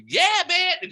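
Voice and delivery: high, loud exuberant holler; loud lovable guy. Retold or imitated. Praising a lovable coworker by imitating him, loud and high. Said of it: "Loud, lovable".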